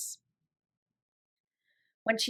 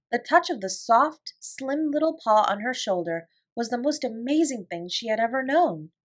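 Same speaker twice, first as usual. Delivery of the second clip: ordinary delivery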